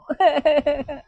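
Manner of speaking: a short wicked giggle. Acted. Wife laughing about tormenting her husband, short wicked giggle. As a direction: giggle at your own cruelty.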